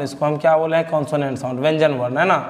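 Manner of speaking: ordinary talk, nothing marked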